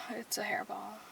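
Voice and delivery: whispered discovery; hushed whisper over a hairball. Real, not acted. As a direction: whisper it like a secret. Noticing a hairball.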